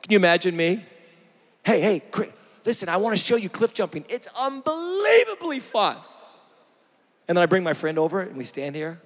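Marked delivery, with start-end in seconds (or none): 1.6-6.0 mock eager pitch. Pastor playing a friend selling cliff jumping, mock excitement. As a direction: hype it up like a friend daring you.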